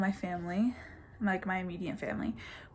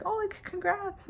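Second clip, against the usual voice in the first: mock excited dad; 12 semitones higher than usual. Imitating dad's excited reaction.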